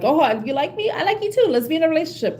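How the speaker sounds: lightly mocking an eager would-be partner; eager new lover. Mimicking someone jumping straight into a relationship, mock eager.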